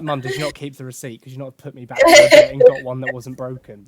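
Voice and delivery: laughing through the joke; giggling complaint. Retold or imitated. Joking complaint to mum.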